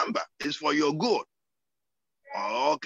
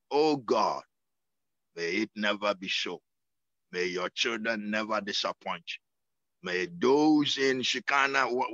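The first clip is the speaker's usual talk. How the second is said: prayer; 5 semitones lower than usual. Acted. Prays for the church.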